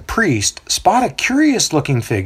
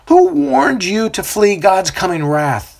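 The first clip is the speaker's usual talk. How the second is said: accusing; 9 semitones higher than usual. A narrator's reading. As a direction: rebuke them as vipers. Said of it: "the baptizer accuses".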